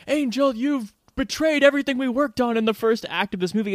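high-pitched hammed-up reproach; mock melodrama. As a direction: play it as an overwrought movie betrayal. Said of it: "this weird melodramatic low moment".

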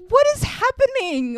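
high-pitched, baffled cry; mock bewilderment. Host relives watching the baffling film, mock bewildered. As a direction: squeak it in comic disbelief.

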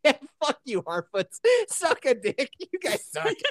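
high, gleeful taunting through laughter; giggly high taunt; mocking laugh. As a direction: jeer at the Harfoots while cracking up. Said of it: "And I was just like".

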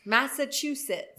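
Massachusetts said "massa-TOO-sit"; mispronounced. Shown as a demonstration. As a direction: say Massachusetts with a T, "too", in place of "chu". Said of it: "I think I said Massachusetts".

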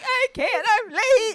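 high, tearful imitation of own sobbing; retold crying. To her mom on the phone, late and stuck, imitated sobbing. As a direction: replay the panicked breakdown, voice breaking. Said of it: "I just broke down in tears".

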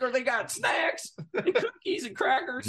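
high, giddy and half laughing; mock excitement. Young flyer marvelling at plane snacks, mock excited. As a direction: gush over every little treat. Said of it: "like, excited".

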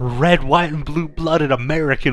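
lightly voiced proud American wrestler; hammed-up flag-waving pride; patriotic wrestler voice. Patriotic wrestler parody, brother.

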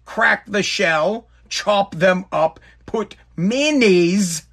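very slow, step by step; walk through it slowly; slow recipe steps. Real, not acted.

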